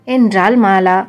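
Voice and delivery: ordinary delivery, nothing marked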